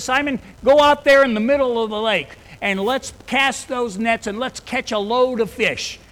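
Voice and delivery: high, loud preaching of the quote; preaching at full force. Acted. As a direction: proclaim it to the whole church. Preacher voicing Jesus loudly.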